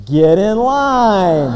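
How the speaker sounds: long, drawn-out mock shout; punchline call. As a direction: stretch every word out for the punchline. Acted punchline of the joke.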